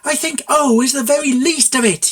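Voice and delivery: high, loud yell. A narrator's reading. Mare yelling at the stallion, high loud fury. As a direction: yell it at him. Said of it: "yelled Cloudkicker".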